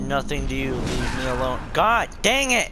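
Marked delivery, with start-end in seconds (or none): 1.7-2.7 loud, high frustrated yell; angry outburst. Real, not acted. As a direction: snap at the game in real anger. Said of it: "See, now I'm getting mad".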